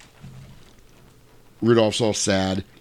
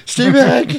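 high, loud frightened warning; mock panic; 8 dB louder, slower than usual. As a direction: shout it as if about to be murdered. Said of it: "acts like Rudolph's gonna murder him".

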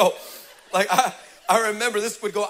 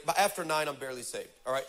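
normal talking voice

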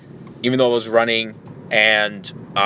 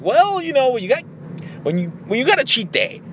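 high, stretched-out well; playful defensiveness; 13 semitones higher than usual. Retold or imitated. Answering a candy store tease, playfully defensive. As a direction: protest in a high, excusing voice.

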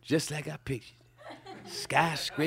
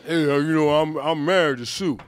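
ordinary talk

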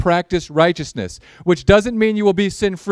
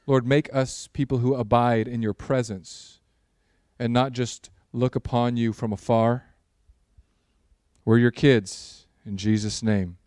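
prayer; 5 semitones lower than usual. Acted. Opening prayer before sermon.

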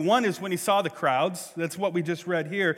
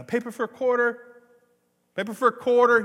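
high, calling newspaper hawker; young newspaper seller; 8 semitones higher, 6 dB louder than usual. Retold or imitated. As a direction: call out a street sales pitch. Child hawking newspapers in the story.

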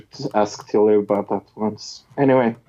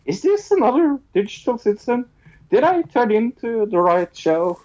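mock-puzzled viewer, pitched much higher; confused listener; 11 semitones higher than usual. Listeners doubting the repeated intro, high mock puzzlement. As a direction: play a baffled fan unsure of the show. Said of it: "will be like".

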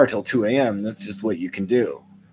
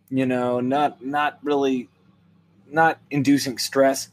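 ordinary delivery

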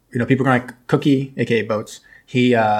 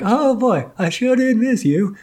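much higher, slow goofy husband voice; nerdy husband; 11 semitones higher, slower than usual. Retold or imitated. Nerdy sailor home greeting his wife, goofy and high. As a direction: play him as a giddy dork. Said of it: "They're like".

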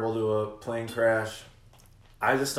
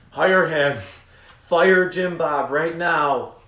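loud demand; 4 semitones higher, 8 dB louder, much slower than usual. Real, not acted. Pleading to team owner.

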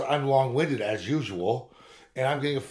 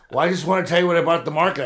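eager husband; 6 semitones higher, 8 dB louder, faster than usual. Retold or imitated. His eager reply to wife.

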